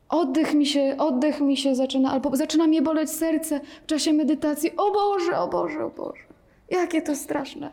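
high, fretful lament, slowing at the end; mock alarm. Teacher parodies a student's mind in meditation, mock fright. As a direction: whine like a scared student.

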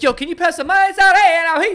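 "Yo, can you pass the mozzarella" high and loud; loud mock Jersey Italian; mock Italian-American. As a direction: ham up an over-the-top Italian-American. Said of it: "It's like".

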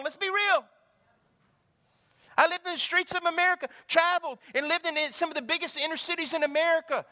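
high, loud preacher's drive; full-force sermon delivery; fervent preaching. Real, not acted. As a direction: preach it with fire to the crowd.

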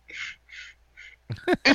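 hissed shush, repeated with pauses; imitated shushing. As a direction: imitate someone going around shushing. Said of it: "your dad went around going".

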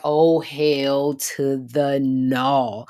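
slow, drawn-out mock refusal; emphatic refusal. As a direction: stretch each word out, low and incredulous. Anna refusing the creepy woman.

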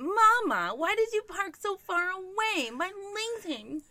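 put-on high whining child voice; whiny kid. Five-year-old whining to mom about the walk, high and whiny. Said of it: "complains the entire time".

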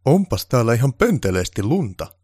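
loud, high-pitched disdain; disgusted by snow. Acted. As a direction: make your hatred of snow clear in every word. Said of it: "lausuen sanansa niin, että kaikille tuli selväksi, että hän vihasi lunta".

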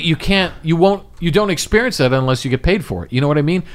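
normal talking voice

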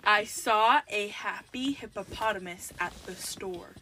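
measured repeat for someone who can't hear. Real, not acted. Repeats slower for headphone wearer.

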